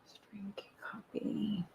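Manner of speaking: very soft, under the breath; half-voiced, absent self-talk; muttering to self. Real, not acted. Streamer talking to self while setting up the video, muttering.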